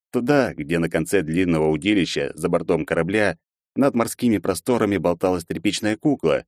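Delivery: ordinary delivery, nothing marked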